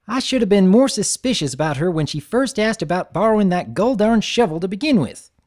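folksy big sister fretting over Apple Bloom; Applejack grumbling. Acted. Applejack voiced in story.

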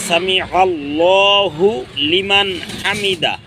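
সামি আল্লাহু লিমান হামিদা slow with a break after আল্লাহু; correct recitation. Shown as a demonstration. Teacher showing the correct split of the phrase, slow. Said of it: "যারা বলে তারা ভুল বলে".

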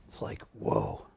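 breathless whispered whoa; hushed amazement; awed whisper. Retold or imitated. Retelling the fifth dimension reveal, awed whisper. Describing seeing the illusion.

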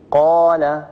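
قال said slowly with a drawn-out alif; lengthened vowel. Shown as a demonstration. Teacher showing a madd letter to students, lengthened vowel. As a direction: stretch the long aa in قال. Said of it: "وقابلية التطويل وقابلية الزيادة".

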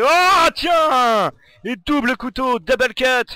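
excited. Real, not acted. Double knife kill in game.

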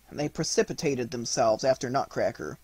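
ordinary delivery

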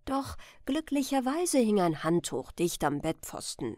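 usual speaking voice

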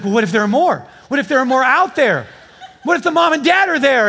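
escalating anxious what-ifs, hammed up; worried wife. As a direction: imitate a wife working herself into a fret. Wife still worrying, retold.

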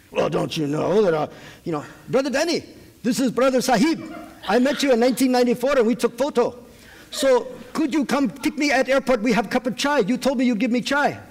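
put-on high, lilting Indian-accented voice; Indian accent caller. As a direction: play the pushy Indian caller asking for a ride.

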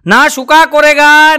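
put-on slow, drawn-out Nepali-accented recital; mock Nepali accent, slowed right down; Nepali guard repeats it. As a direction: stretch every word like the proud watchman. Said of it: "એણે કીધું".

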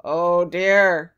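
loud, low giant's sigh. A narrator's reading. Giant dismayed by Kit's threat, sighing hugely. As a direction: heave a huge, weary sigh on the words. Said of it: "sighed the giant".

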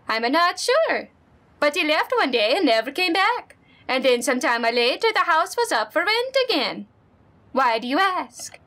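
put-on friendly neighbor lady, lightly accented; Honda-san voice. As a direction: play the sweet old neighbor.

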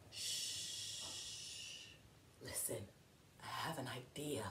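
hushed, conspiratorial storybook whisper; shush, then whisper it very softly; secretive whisper. Acted. Grover shares an idea with the reader, whispering.

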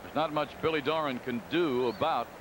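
usual speaking voice

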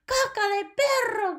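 high, loud witch shriek. A narrator's reading. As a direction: scream it in chorus.

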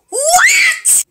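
high, loud yelled question; own dad impression. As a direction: imitate dad with an exaggerated shout. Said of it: "my dad be like".

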